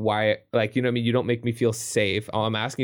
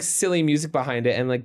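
ordinary talk

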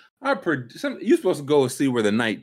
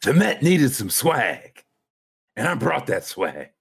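Eric Adams voice; 3 semitones higher, 3 dB louder, slower than usual. Acted. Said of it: "you read as Eric Adams".

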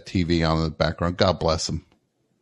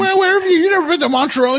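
high, loud disbelieving shriek; can't believe what he just heard; playful mock outrage, pitched way up; incredulous; 4 dB louder, faster than usual. Real, not acted. Host shocked caller never visited.

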